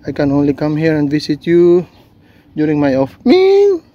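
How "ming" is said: high, loud call across the yard. Real, not acted. Woman calls her cat Ming from afar, loud high call. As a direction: call the cat's name out loud.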